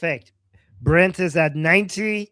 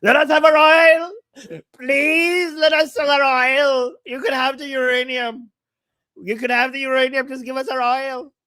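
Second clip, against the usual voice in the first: high, loud hammed-up pleading with a crying edge; mock begging; 5 semitones higher, 6 dB louder than usual. Iran begging Trump to sell oil, high loud mock crying plea. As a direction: beg for the oil like a desperate child, overdone. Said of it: "they actually told him".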